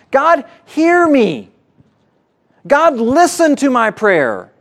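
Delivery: a preacher voicing a psalmist's bold plea; bold demand. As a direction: demand that God listen. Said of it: "the boldness with which he's going and saying that".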